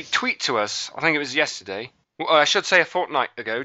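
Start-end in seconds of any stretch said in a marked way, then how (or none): 2.2-3.6 a quick attempt at an English accent on fortnight; playful mock English accent, a bit higher. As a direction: put on a light English accent for the word fortnight. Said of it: "I want to say fortnight in an English accent".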